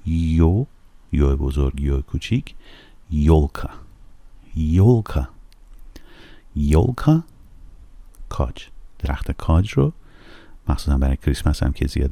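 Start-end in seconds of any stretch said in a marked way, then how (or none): 3.1-7.2 'یولکا' starting with a yo sound; Russian word. Shown as a demonstration. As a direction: stress the first syllable of 'یولکا'.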